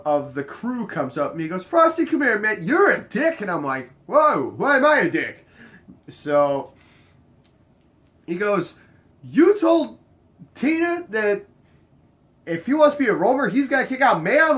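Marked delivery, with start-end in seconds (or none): 1.7-3.4 higher, louder calling-out of a friend; acted-out complaint, pitched up; mock accusation. Retold confrontation by a crew member. 4.1-5.5 higher, louder protest; retold offended comeback; mock taken aback. His retold startled reply. 9.2-14.6 high, loud accusing question; pitched-up retold accusation; mock indignant complaint. Crew member's retold complaint.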